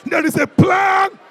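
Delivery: high, loud, drawn-out declaration; defiant preacher's shout; with attitude. Shown as a demonstration. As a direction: shout it with attitude, stretching the words. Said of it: "Say it with some attitude".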